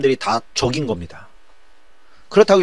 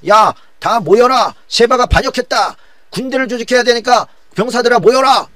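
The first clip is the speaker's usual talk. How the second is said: loud, high-pitched muster call; 10 semitones higher, 4 dB louder than usual. Acted. Amasa summoning soldiers against Sheba's revolt, loud commanding call.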